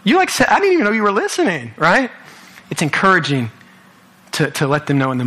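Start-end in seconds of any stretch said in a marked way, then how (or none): 0.0-2.1 high, delighted disbelief; surprised. Retold or imitated. Youth pastor surprised by thanks, high disbelieving reply. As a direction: squeak up in happy surprise. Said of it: "I'm like".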